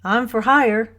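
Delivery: upbeat self-introduction, speaking up; cheerful. A narrator's reading. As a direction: offer yourself cheerfully, voice lifted. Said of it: "he said cheerfully".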